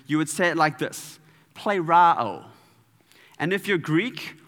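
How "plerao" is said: louder, hammed-up American accent; put-on US accent. Shown as a demonstration. Speaker showing how Americans would say it, put-on US accent. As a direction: say it with a mock US accent. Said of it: "trying to put on a U S accent".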